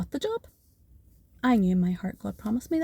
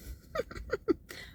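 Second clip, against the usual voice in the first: a burst of high, breathless laughter; high airy laugh; 7 dB quieter than usual. Real, not acted. Thrilled at the job offer email, a high soft laugh.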